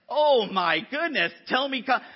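loud, high, exasperated outburst; mock indignant outcry; riled up. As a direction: bristle and raise your voice, all riled up. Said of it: "when I'm all riled up".

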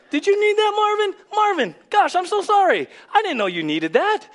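hammed-up, laughing through a fake sorry; mock forgetful Father. Pastor mocking a God who forgets Marvin, laughing high apology. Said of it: "and he's like".

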